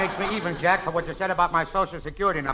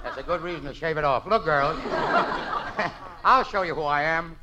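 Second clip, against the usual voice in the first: ordinary talk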